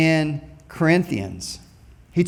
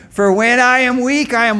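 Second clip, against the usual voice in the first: louder, higher call for the response; call again, bigger; 7 semitones higher, 7 dB louder than usual. Shown as a demonstration. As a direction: push the crowd harder this time. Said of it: "Let's try that again".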